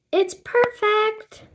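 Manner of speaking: high, slow gush of delight. Acted. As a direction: swoon over the outfit. Friend gushes over outfit.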